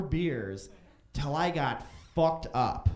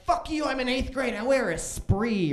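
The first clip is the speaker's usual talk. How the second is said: snotty sister; 7 semitones higher, 4 dB louder than usual. Retold or imitated. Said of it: "And she's like".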